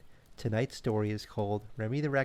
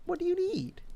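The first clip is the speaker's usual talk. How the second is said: much higher, bright and willing; cheerful raccoon. Acted. Remy agreeing to help his friend, much higher and cheerful. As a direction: chirp it out as a happy helper. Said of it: "said Remy".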